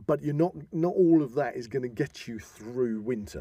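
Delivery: ordinary delivery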